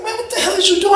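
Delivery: very high mock-alarmed squeal; incredulous protest. Man watching his cellmate snap, high incredulous protest. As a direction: plead for calm in a squeaky high pitch.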